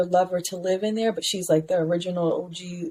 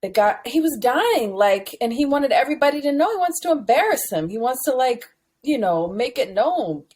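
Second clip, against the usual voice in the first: ordinary talk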